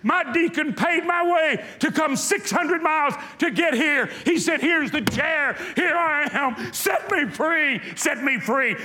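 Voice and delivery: man who drove six hundred miles cries out; desperate shout. Retold or imitated. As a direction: shout it as a man begging for freedom. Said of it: "he slammed it down, and he said".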